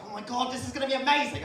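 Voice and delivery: high, loud, thrilled gush; acted giddy enthusiasm; gushing excitement. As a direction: burst out with over-eager delight. Acting out his own eager reply.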